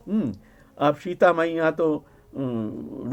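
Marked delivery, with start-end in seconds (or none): none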